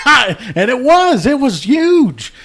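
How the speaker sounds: high, loud and slow, stretched through laughter; laughing. Real, not acted. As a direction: drag the words out while cracking up. Laughing at his own story.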